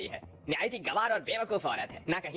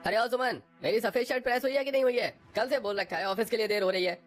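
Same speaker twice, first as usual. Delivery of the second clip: calling out; 7 semitones higher than usual. Acted. Husband shouts for his shirt.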